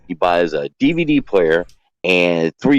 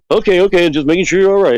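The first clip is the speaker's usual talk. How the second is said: higher, louder imitation of a friend backing off; quick to placate, pitched well up; mock placating, high-pitched; 12 semitones higher, 5 dB louder than usual. Acted friend backing off when answered.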